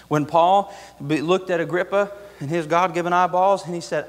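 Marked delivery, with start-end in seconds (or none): none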